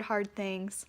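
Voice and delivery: ordinary delivery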